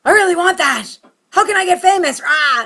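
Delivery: high, loud fame-hungry holler; hungry outburst. Acted. Comic telling what her outside says, hungry outburst. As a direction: shout it out, ending on a roar. Acting out outward hunger for fame.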